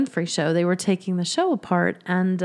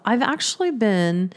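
ordinary talk

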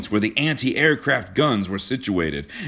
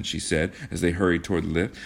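plain reading voice

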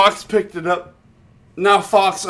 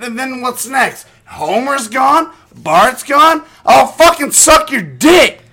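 louder, higher, building outrage; mock rant; 5 semitones higher, 5 dB louder than usual. Drunk fan ranting to cohost about Apu's removal, loud. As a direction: rant like the show betrayed you. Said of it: "Here's my John's fucking rant".

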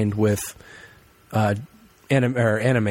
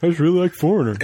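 mocking imitation; 7 semitones higher, 3 dB louder, faster than usual. Mocks co-host's pick.

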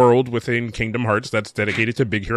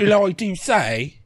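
put-on British accent; 7 semitones higher, slower than usual. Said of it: "doing a British accent".

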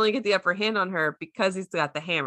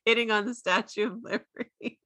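ordinary delivery

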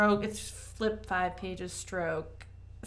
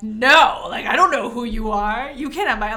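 retold screaming protest; 3 semitones higher, 11 dB louder than usual. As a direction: re-enact a loud, panicked protest to a stranger. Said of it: "I've been screaming at this man".

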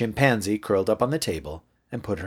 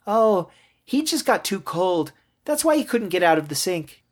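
put-on high child's voice, realizing; high-pitched childish reasoning; little girl; 10 semitones higher than usual. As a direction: explain it away like a hopeful small child. Girl explains away Duck's stillness.

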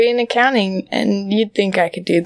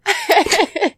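high breathy giggle burst; hiccup giggles; 9 semitones higher than usual. Real, not acted. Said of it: "I can't stop laughing now".

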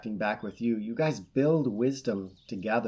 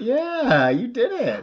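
much higher, louder cheering with a laugh; excited; 12 semitones higher, 9 dB louder, slower than usual. Real, not acted. Excited praise after voice.

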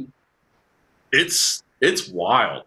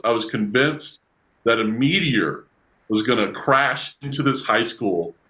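ordinary talk

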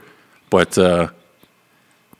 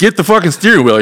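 much higher, louder panicked order; frantic shout; 9 semitones higher, 4 dB louder than usual. Retold or imitated. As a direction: yell it over the chaos. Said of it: "I'm like".

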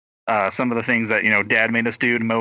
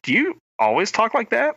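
higher, puzzled mimicking question; puzzled colleague; 9 semitones higher than usual. Shadowing colleague questions her accent, higher puzzled voice. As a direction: mimic someone taken aback, pitched up. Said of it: "then they're like".

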